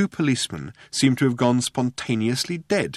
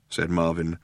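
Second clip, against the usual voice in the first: usual speaking voice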